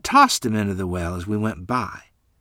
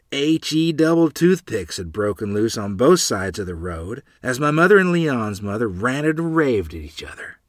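ordinary delivery

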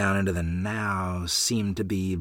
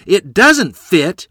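higher, much louder, slow outburst; loud exclamation; 8 semitones higher, 11 dB louder, much slower than usual. Acted. Neighbor denying she is a liar, loud exclamation. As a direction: fling the denial back hard. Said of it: "Miss Haycock exclaimed".

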